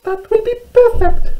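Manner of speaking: very high, squeaky tiny mouse voice; little mouse. Acted. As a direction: exclaim in a loud mouse squeak. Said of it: "she said".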